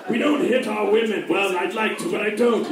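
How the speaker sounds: a gruff prince lecturing on manners, lightly voiced; playing the proud warrior scolding Goku; mock Vegeta voice. Vegeta lectures Goku on manners, lightly voiced.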